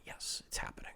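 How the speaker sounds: hushed little cheer to himself; excited whisper. Retold or imitated. Podcaster secretly cheering growing female audience, in a whisper.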